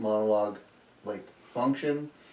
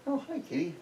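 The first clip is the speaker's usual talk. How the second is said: high, soft kitty-greeting voice; greeting a pet; 11 semitones higher, 7 dB quieter, faster than usual. Real, not acted. Host to a cat mid-show, high soft pet voice. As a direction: coo it softly to the cat, way up high.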